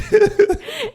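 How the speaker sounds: shrieking laugh; high laugh. Real, not acted. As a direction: burst out laughing. Laugh alone.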